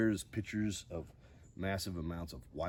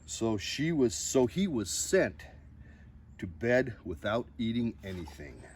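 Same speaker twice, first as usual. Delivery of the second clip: lively storybook reading with big rises; reading to children; 3 semitones higher, 8 dB louder than usual. Acted. Host reading a picture book to viewers, expressive storybook voice. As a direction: read the bedtime book aloud with drama.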